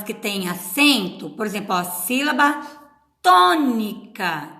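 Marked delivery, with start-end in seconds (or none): 1.8-4.5 "tônica" with a loud, lengthened TÔ; "tônica" slowed, TÔ punched out; stress on TÔ. Shown as a demonstration. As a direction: lean hard on the first syllable of "tônica". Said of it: "o tom fica mais forte".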